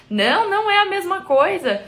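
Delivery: bem mais agudo e mais forte, insistindo; réplica exaltada imitada. Retold or imitated. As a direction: rebate como quem briga nos comentários. Briga imitada nos comentários. (resposta indignada imitada, aguda e forte)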